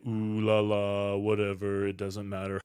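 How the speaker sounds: stifle a laugh and let it sound dopey. Shown as a demonstration. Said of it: "we have dopiness".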